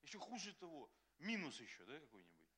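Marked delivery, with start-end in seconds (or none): none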